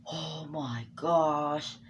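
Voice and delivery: gasp, then a slow, drawn-out oh my gosh; stunned gasp. Retold or imitated. Friends on the sideline gasping as the rock nearly hits him. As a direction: let the shock stretch the words out. Friends react to near miss.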